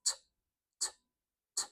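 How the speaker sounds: isolated "T" as a sharp puff of air; aspirated T. Shown as a demonstration. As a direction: pop each "t" without any vowel. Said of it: "puff of air".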